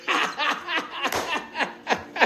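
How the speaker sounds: high breathy cackle at his own tale; high laughter. Real, not acted. Telling friends the story, quiet high laugh.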